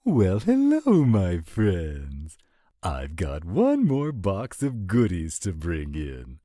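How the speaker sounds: big, high, booming hello; loud theatrical entrance; exuberant greeting. Acted. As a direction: call out to the whole room on arrival. Guest arrives calling out greeting.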